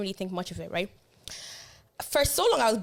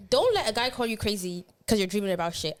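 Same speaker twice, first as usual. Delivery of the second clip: normal talking voice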